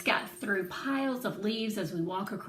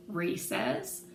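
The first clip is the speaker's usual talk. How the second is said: ordinary delivery, nothing marked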